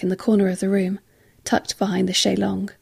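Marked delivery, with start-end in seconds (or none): none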